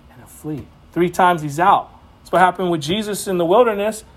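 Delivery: ordinary talk, nothing marked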